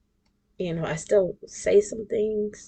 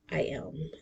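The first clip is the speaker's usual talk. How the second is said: whispered aside; 7 dB quieter than usual. Real, not acted. Aside about being forty-one.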